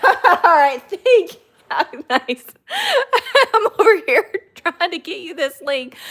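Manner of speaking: high, loud laughing thanks. Real, not acted. Streamer thanking viewers for finding the link, laughing.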